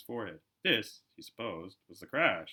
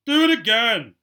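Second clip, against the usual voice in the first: much higher, loud demanding shout; bossy order; 11 semitones higher, 18 dB louder, slower than usual. A narrator's reading. Dudley orders his father to rap the glass again. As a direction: bark it like a spoilt boss. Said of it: "Dudley ordered".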